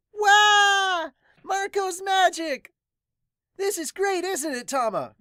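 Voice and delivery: put-on very high, loud squeal of a thrilled kid; a little kid bursting with wonder; delighted child. Child cheering Marco's magic to Tama, squealing high.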